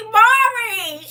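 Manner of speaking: high, loud blurt; sudden loud cry; comic shout. Real, not acted. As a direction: shout it like a talk show. Comic exclamation.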